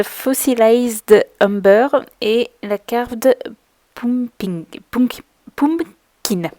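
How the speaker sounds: careful, slow English color names with a French accent; English accent attempt. Shown as a demonstration. Crafter reading English ink names, self-conscious French accent. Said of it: "ne vous moquez pas de mon accent anglais".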